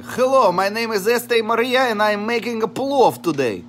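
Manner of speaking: loud, high cheery host intro; demo intro line. Shown as a demonstration. Partner coaching her on-camera intro, cheery host voice. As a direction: show her how to greet the camera. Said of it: "You should say".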